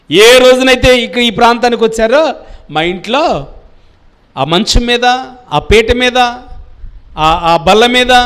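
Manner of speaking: loud, high pleading insistence; a mother insisting her guest stays; woman's eager invitation. Acted. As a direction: urge the prophet to stay, loudly. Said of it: "ఏమని చెప్తుందో తెలుసా అండి".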